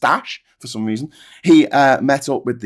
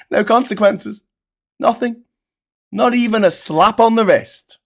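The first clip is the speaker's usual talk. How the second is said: indignant; 8 semitones higher than usual. Real, not acted. Indignant outburst, higher pitch.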